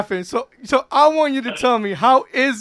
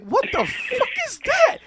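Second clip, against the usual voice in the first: shocked; 12 semitones higher, 4 dB quieter than usual. Retold or imitated. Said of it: "And I'm like".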